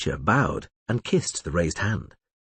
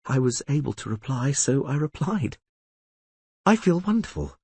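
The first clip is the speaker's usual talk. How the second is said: Yennefer, touched; 4 semitones higher than usual. A narrator's reading. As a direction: let her voice lift, moved. Yennefer voiced by narrator.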